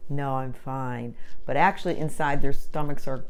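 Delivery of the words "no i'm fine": slow, soft forced cheer; fake fine. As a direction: cover up upset quietly. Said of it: "look, smile, happy".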